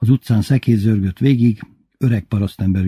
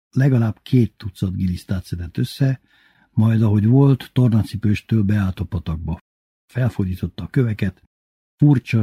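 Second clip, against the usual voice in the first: usual speaking voice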